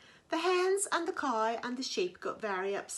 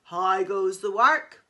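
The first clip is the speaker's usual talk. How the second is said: loud bossy farmer call; lazy farmer bellowing from bed; 3 semitones lower, 11 dB louder than usual. Acted. Farmer calling, repeated.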